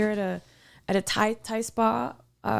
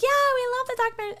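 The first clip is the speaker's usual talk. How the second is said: high, quick gushing imitation; mock eagerness; 14 semitones higher, much faster than usual. Young fans answering about a documentary, high mock-eager voice. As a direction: gush in a high put-on voice.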